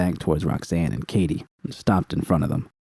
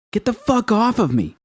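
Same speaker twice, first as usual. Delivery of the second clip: pained shout. A narrator's reading. Judy screaming at the man yanking her hair. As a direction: yell at the attacker to let go.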